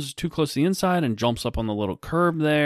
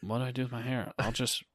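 muttered quote; 8 dB quieter than usual. Retold or imitated. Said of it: "And this girl's like".

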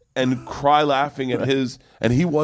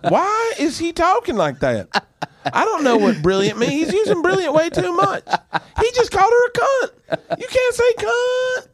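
baffled red-state viewer; 4 dB louder than usual. Retold or imitated. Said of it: "a lot of the red states going".

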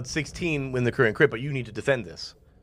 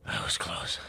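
breathless whisper, much softer; 11 semitones lower, 9 dB quieter than usual. Acted. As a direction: breathe it out in relief. GM whispers relief.

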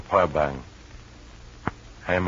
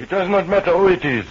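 1 agitated; 9 semitones higher, 5 dB louder, faster than usual. Acted. Feverish prisoner refusing to name Eloise.

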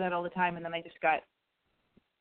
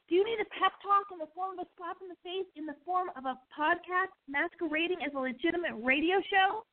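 hyped announcer voice; 8 semitones higher than usual. Acted. Hyped show intro voice.

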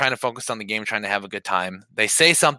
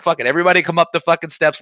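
raised, quick reenacted yell; retold shouting, higher and louder; retold screaming challenge; 6 semitones higher, 5 dB louder, faster than usual. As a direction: rattle off a heated call-out. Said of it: "at this point, I'm screaming".

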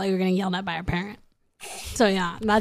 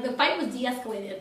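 ordinary talk, nothing marked

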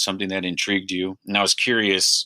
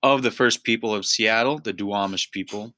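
formal show opening; 3 semitones higher, 3 dB quieter than usual. Acted. Host opens the episode for listeners, formal announcer voice. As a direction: announce the podcast like a station ident.

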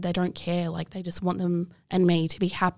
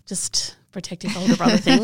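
breathy whispered aside, a little amused; whispering a wry aside; 5 dB louder than usual. Real, not acted. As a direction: drop to a whisper for the joke. Wry aside about brother.

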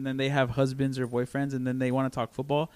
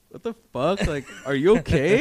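mock alarmed; 3 semitones higher, 7 dB louder than usual. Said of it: "and people are like".

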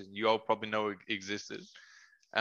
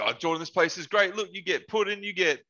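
higher-pitched, mildly hammed-up enthusiasm; imitating excited friend; 8 semitones higher than usual. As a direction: eagerly sell it like an excited pal. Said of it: "his excitement for the day".

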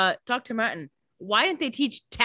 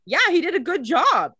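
higher, louder mocking Catherine voice; callous Catherine quip; 11 semitones higher, 5 dB louder than usual. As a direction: toss it off like a heartless vampire. Catherine's callous quip about Trevor.

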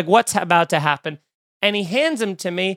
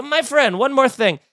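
lightly played Columbo afterthought, higher; one more thing, casually; Columbo-style afterthought; 7 semitones higher than usual. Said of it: "like an Indian Columbo".

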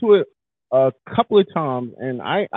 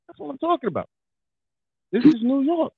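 hyped up; 10 semitones higher than usual. Retold or imitated. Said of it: "I was like".

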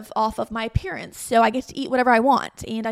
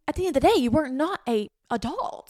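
normal talking voice

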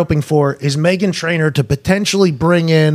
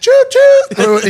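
slow, higher, louder choo-choo like a train conductor; mock train call; 6 semitones higher, 6 dB louder, much slower than usual. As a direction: ham up the train whistle.

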